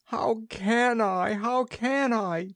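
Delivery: the witch frets she has lost her magic; chattering witch. A narrator's reading. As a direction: flap and fret. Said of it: "chattered the witch".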